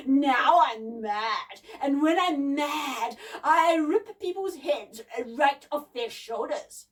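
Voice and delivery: loud, slow, menacing snarl; drawn-out mechanical monster threat; snarling gramophone giant. A narrator's reading. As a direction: snarl it slowly in a booming voice. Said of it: "snarled the gramophone".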